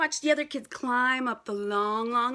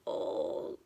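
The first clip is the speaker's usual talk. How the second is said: nervous oh; 6 dB quieter than usual. Acted. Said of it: "Jabari squeezed back, saying".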